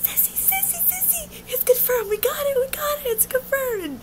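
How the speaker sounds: very high, near-crying squeal; shrieking excitement. Retold or imitated. Smacking her friend's arm, a high excited squeal. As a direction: squeal it like a dream came true. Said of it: "I'm like".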